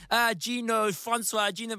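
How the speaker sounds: high, teasing sing-song; mocking schoolkids. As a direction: chant his name like playground bullies. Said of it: "we'd be like".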